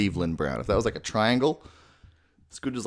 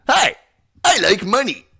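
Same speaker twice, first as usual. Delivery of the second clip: much higher, louder greedy pirate; Mister Krabs voice; 12 semitones higher, 7 dB louder than usual. Shown as a demonstration. Voice tutor playing Mister Krabs. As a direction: crow about money like a miser. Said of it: "Mister Krabs".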